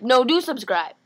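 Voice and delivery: loud burst on "do subscribe"; playful loud correction; emphatic reversal. Pretend. Loudly reverses the quiet warning.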